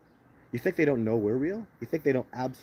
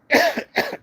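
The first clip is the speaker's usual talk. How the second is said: sharp dry cough; coughing fit; 8 dB louder than usual. Real, not acted. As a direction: cough hard, mid-sentence. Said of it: "Sorry I'm coughing so much".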